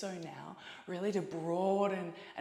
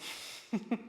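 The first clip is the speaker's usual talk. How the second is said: short breathy laugh; 5 semitones higher than usual. Real, not acted. Laughs during prop list.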